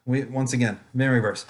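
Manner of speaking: ordinary delivery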